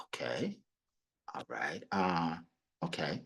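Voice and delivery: soft, unvoiced and slow okay; hushed, caught off guard; taken-aback whisper. Client backs down after salesman refuses to rush. And she looked at me like.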